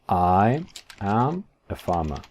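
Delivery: slow, word by word; careful, spaced-out English; slow model sentence. Shown as a demonstration. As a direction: pause between the words like a language teacher. Teacher models English sentence slowly.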